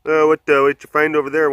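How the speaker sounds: high, loud silly critter voice; playful animal chatter voice; lemur voice. Acted. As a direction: voice the lemurs chatting over food. Voicing foraging lemurs' chat.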